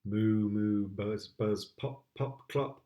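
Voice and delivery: ordinary delivery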